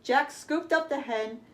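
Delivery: put-on lively, bright read-aloud for kids; storybook reading. A narrator's reading. Reading Jack and the Beanstalk to children, lively storyteller voice. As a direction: tell it like a bedtime tale with big moments.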